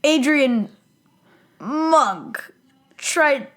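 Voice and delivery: high, drawn-out words with big pauses; milk each word for melodrama; mock-dramatic announcement, word by word; hammed-up dramatic. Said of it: "being all dramatic and stuff".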